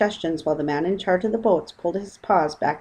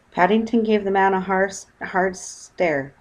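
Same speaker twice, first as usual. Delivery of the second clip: plain reading voice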